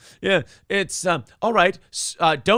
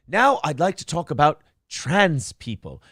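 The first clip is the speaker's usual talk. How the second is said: usual speaking voice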